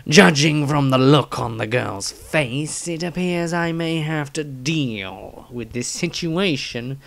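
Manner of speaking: slow, disdainful surgeon voice; slow, contemptuous character read; Dieter's diary voice. Acted. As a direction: drag it out slowly, full of scorn.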